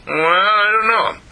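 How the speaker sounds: high, drawn-out reluctance; doubtful storybook skunk, slow and high; hesitant skunk. A narrator's reading. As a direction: hem and haw in a high skunk voice. Skunk doubts the dog's invitation.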